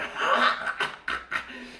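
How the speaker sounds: mock insane cackle, breaking into staccato; imitated crazy laugh. Son laughing at dad's whipping, mock crazed laugh. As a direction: act out laughing like a madman. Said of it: "start laughing, uh, like crazy, like I'm going insane".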